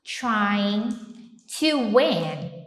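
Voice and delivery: slow English with pauses between words; slow model sentence. Shown as a demonstration. Teacher modelling an English sentence slowly for students. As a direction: lay each word out slowly. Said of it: "a gente falaria assim, ó".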